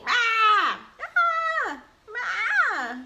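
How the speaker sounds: high, loud mock roars; playful yell. Showing the baby what yelling is, playful high yells. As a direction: let out short play roars for a baby.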